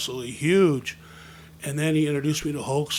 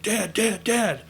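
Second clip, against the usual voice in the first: insistent, raised-pitch calls for Dad; pestering child; 7 semitones higher, 5 dB louder, slower than usual. Retold or imitated. Kid trying to get his dad's attention, pestering. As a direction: keep tugging for Dad's attention.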